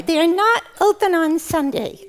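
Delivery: put-on high-pitched childlike puppet voice; squeaky puppet answer; puppet character. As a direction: explain it matter-of-factly as a little puppet. Puppet explains to the host.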